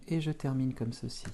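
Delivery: usual speaking voice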